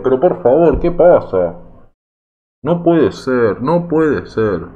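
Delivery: loud exasperated cry of disbelief; fed-up gamer yelling at the screen; frustrated outburst. Real, not acted. Outburst at a bad goal.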